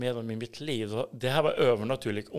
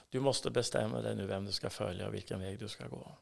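calm Jesus; 3 semitones lower, 7 dB quieter than usual. Retold or imitated. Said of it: "då säger Jesus så här".

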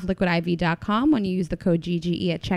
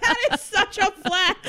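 high, slow words squeezed through laughter; laughing hard; 11 semitones higher, slower than usual. Real, not acted. Co-host teasing a birthday-trip brag, laughing hard. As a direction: barely get it out for laughing.

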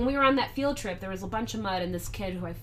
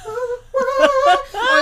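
high, whimpering squeal; flattered squeal; 9 dB louder than usual. Retold or imitated. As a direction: squeal with delight. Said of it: "they're like".

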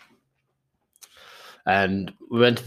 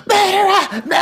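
high shrill-free mock shriek, much louder; retold scream; 7 dB louder than usual. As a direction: shriek the accusation across the bar. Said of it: "screaming "murderer" at me".

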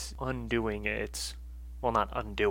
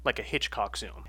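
normal talking voice

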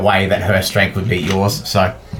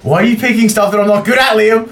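high, whining complaint; mock-whine at the DM; whiny; 11 semitones higher than usual. Real, not acted.

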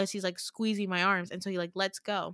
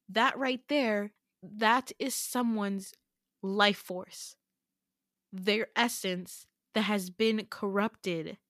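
slow, deliberate, spaced-out phrases; measured, weighty retold explanation; grave revelation; slower than usual. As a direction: act out breaking serious news, pausing between phrases.

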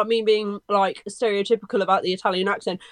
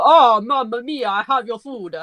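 put-on drawn-out, hammy Italian accent; mock Italian accent; slower than usual. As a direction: do a cartoon Italian delivery man accent. Mock Italian delivery rider quote.

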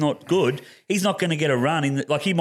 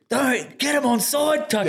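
imitated fan yelling; 11 semitones higher than usual. Mimicking a fan at the touch judge, yelling. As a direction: yell like a heckler at the touch judge. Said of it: "he's yelling out going".

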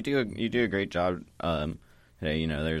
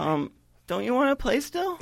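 put-on high, pleading child voice; little girl voice; 4 dB louder than usual. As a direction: beg to keep playing.

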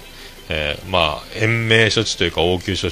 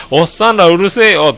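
loud, high-pitched re-enacted yell; raised-voice yell; 11 semitones higher, 9 dB louder than usual. Re-enacting own outburst at rowdy drinking companions, over-loud yell. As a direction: belt it out far too loudly. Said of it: "声が、すごい声張りすぎて".

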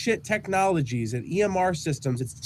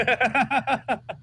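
hiccup giggles; 8 semitones higher than usual. Real, not acted. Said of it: "Stop making me laugh".

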